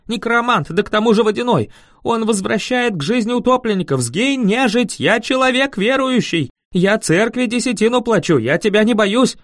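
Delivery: high, loud, frightened tirade; panicked ranting. Acted. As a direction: fend off the undead with frantic protests. Terrified peasant banishes the supposed necromancer.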